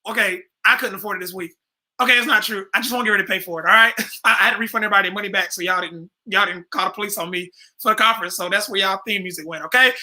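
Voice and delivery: ordinary talk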